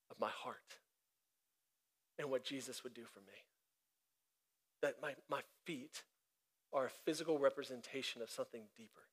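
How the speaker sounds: softly sharing what the moment meant; hushed reflection. Acted. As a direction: pull them close and drop the voice.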